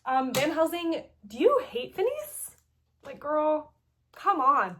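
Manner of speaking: loud, high, nosy pestering question; teasing prod. Retold or imitated. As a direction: needle him like a gossipy friend.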